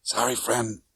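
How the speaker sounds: soft, weary and drained; tired voice. Acted. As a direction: say it exhausted, fading. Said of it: "in a tired voice".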